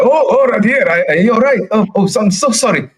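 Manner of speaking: high, rushed, fretful voice; a nervous coachman babbling sorry; flustered apologetic NPC. Acted. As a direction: gush apologies in a panicky high voice. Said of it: "And you see him like".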